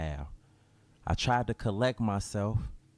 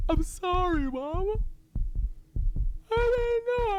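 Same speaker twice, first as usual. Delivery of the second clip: sobbing apology. Acted. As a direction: sob out the apology. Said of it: "how could they just cry like that".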